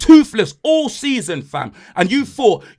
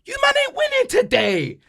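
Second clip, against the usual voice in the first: much higher, sing-song taunt; mock high taunt. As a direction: crow it high to tease the rivals. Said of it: "I said".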